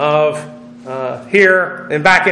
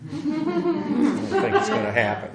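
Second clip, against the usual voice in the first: laughing; 9 semitones higher, 9 dB quieter than usual. Real, not acted. Joking about Patriots drafting someone.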